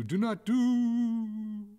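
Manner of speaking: high, loud wail stretched out endlessly; desperate cry held on one high note; freezing mid-cry. A narrator's reading. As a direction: wail the last word until it freezes. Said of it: "the words froze".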